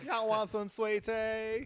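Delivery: high, slow Southern drawl; North Carolina accent. Acted. Friend joining the Southern bit, high, slow Southern accent. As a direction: draw out a sugary Southern offer. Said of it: "North Carolina".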